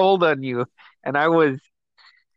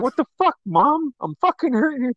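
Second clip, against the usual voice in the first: higher, indignant retold yell; mock outrage; 10 semitones higher than usual. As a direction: pitch it up like an outraged kid complaining to Mom. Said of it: "I was like".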